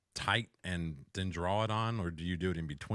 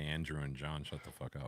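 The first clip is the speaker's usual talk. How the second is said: low, softer imitation; soft mock quote; 5 semitones lower, 8 dB quieter than usual. Doubting John would say it, muttered quietly. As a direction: mutter it under the breath. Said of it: "I don't think John would say".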